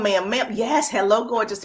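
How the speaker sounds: usual speaking voice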